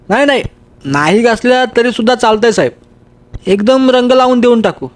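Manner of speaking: crafty helper coaxing his master; lazy workman. Acted. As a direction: wheedle the boss to skip the work. Workman's voice in story.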